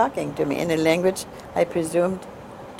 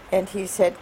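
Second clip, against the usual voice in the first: ordinary talk, nothing marked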